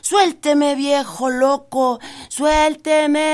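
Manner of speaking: high rabbit pleading shout, slow on viejo loco; trapped and begging, yell it out. Acted. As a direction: conejo gritando que lo suelten. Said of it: "Siguió gritando".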